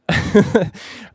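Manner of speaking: unvoiced burst of laughter; breathy laugh. Real, not acted. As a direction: laugh it off in surprise. Siri triggered by accident.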